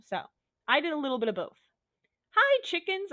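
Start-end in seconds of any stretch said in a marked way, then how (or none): 2.3-3.1 high, loud squeal; high-pitched playful call; squealed greeting. Acted. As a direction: squeal a goofy hello down a hallway. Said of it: "I squealed down the hallway".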